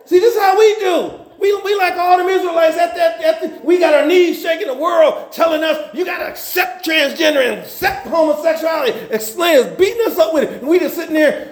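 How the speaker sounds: high, shouted preaching; fired-up preacher building to a shout; impassioned preaching. Real, not acted. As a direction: preach it hard to the congregation. Preaching at full force.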